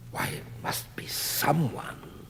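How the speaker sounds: soft breathy whisper of dawning realization. Acted. Reading the sculptor's answer to the audience, whispered. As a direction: breathe it as a secret discovery. Wondering aloud within the letter.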